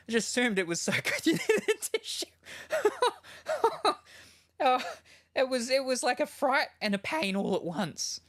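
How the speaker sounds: high-pitched, laughing through the shock; pained laughter. Real, not acted. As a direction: squeal it out while laughing off the pain. Laughing off painful headset shock.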